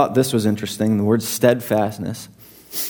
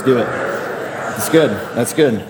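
usual speaking voice

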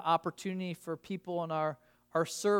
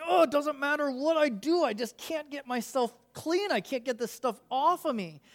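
higher, hammed-up panicking whine; mock panic; 6 semitones higher than usual. Preacher imitating a panicking man under soap. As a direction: play the man who can't get the soap off. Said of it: "He's panicking 'cause he's just like".

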